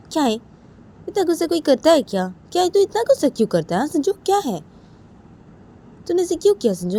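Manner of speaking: high-pitched acted scolding; indignant cousin telling him off; heated reproach. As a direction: take him to task, voice climbing. Cousin reproaches Sanju over the slap.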